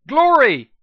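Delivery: high, loud call of a name; yell. A narrator's reading. Hero yells for her friend while dodging a stinger. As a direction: shout for help mid-fight. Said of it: "I yelled".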